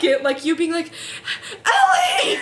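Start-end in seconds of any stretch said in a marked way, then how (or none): 1.7-2.4 much higher, louder call of a name; sudden high yell after someone. Retold or imitated. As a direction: shout the name across the platform. Friend calling from the leaving train.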